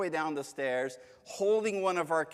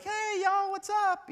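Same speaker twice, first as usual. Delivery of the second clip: much higher, breezy buddy greeting; mock casual God; 4 dB louder than usual. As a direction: play God as a laid-back pal. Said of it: "I just don't picture God be like".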